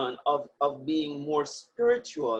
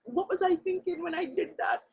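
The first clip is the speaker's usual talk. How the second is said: higher-pitched talking to oneself; acted inner voice, pitched up in dismay; honest self-reproach; 9 semitones higher, 3 dB quieter than usual. Speaker voices someone admitting a past wrong to themselves, honest self-reproach. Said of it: "be honest to yourself and say".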